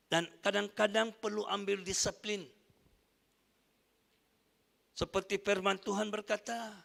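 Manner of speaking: normal talking voice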